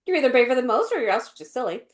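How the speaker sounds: quick, throwaway delivery; moved past it. Retold or imitated. Imitating the British Missus Beaver, moving quickly past it. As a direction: rattle it off without emphasis. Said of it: "they just moved past it".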